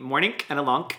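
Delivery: "morning" and "lonk" with a hard k ending; mimicked hard endings. Mocking the hard final g.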